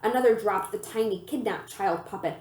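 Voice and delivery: usual speaking voice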